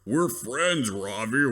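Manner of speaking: lightly voiced simple-minded brute, dragged out; big dumb gorilla. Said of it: "the big kind of dumb".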